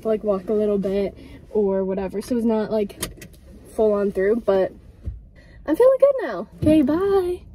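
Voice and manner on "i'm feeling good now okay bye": excited. Real, not acted. Happy sign-off after run.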